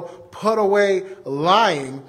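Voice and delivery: slow, deliberate, every word weighted; slow emphasis. Acted. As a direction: lay each word down slowly.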